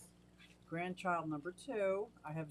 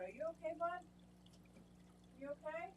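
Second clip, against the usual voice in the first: higher, softer coaxing of a pet fish; soft worried pet talk; 8 semitones higher, 13 dB quieter, faster than usual. Real, not acted. Owner anxiously coaxing her Oscar fish, higher and softer. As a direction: coo anxiously at the fish to check he's alright.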